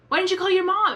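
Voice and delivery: high, loud questioning; exasperated disbelief. Real, not acted. Viewer scolding an on-screen character, exasperated. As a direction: call out the obvious in disbelief.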